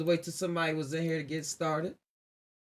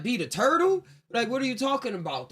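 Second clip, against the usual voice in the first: higher-pitched mock disbelief; incredulous; 5 semitones higher than usual. Listener scoffing at the tortoise and hare story, incredulous. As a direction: question it as if it's absurd. Acted scoffing reply to the fable.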